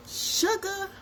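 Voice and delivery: drawn-out, high-pitched coo; playful squeal. Pretend. Storyteller showing off the nickname Sugar, playful high coo. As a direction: sing out the nickname in a giggly high voice.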